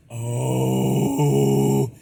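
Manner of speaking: rattling voice cracking into air; vibrating false-chord distortion. Shown as a demonstration. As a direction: sound like talking through a fan. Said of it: "So I go like".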